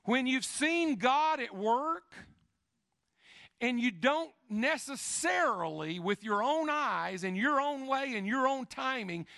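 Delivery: high, loud preacher's climb; impassioned sermon delivery; full-force preaching. Real, not acted. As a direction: preach it out to the back row.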